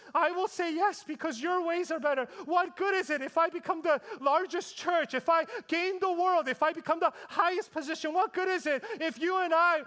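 high, loud, driving sermon climax; preaching at full force. Acted. Pastor urges the congregation to choose God, fervent preaching. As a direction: build the rhetorical questions to a peak.

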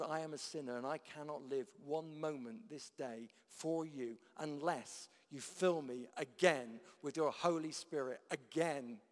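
ordinary talk, nothing marked

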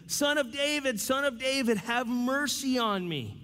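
high, pleading cry for mercy. Retold or imitated. Blind beggar calling to Jesus over the crowd, high pleading cry. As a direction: call out to him, high and desperate. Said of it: "the beggar's crying out for him".

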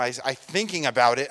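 usual speaking voice

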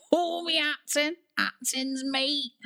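high, cackling at his own joke; wheezing with laughter, slow at the end; laughing old sailor. Acted. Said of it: "folded over with laughter at his own joke".

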